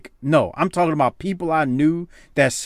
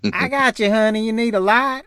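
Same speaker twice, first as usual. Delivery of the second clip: put-on girly voice; 10 semitones higher than usual. Imitates helpful smoker girl.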